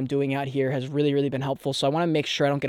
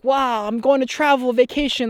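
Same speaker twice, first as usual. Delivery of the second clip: high, bright tourist gush; mock vacation excitement; 10 semitones higher, 4 dB louder than usual.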